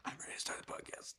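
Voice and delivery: hushed, breathy whisper. Real, not acted. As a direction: whisper it back. Whispered reply.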